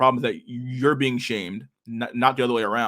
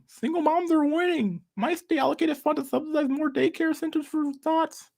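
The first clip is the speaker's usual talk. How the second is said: hammed-up high parody; mocking impression. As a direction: sneer it in a squeaky mock pundit voice. Repeats in the mock voice.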